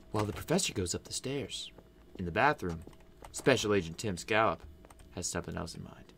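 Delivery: soft low narrator voice; story narration. A narrator's reading. Narrator sets the scene in the bathroom, soft and low. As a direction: narrate quietly like a trailer.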